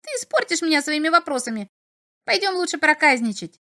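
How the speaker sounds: high, pestering little imp. A narrator's reading. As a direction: needle him like a curious imp. Imp coaxes.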